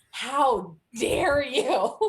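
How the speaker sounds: loud, slow, hammed-up indignation; mock outrage. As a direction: play the offended customer, drawn out. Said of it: "somebody was irate".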